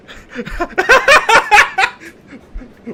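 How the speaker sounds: a big high burst of scornful laughter; loud mocking laugh. Real, not acted. Scoffing at the chief's parley offer, a loud high mocking laugh.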